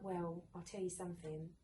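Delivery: put-on soft, confiding reassurance to a child; the mother reassures her daughter, softly; reassuring. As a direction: let her know gently that Daddy was proud. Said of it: "And I went".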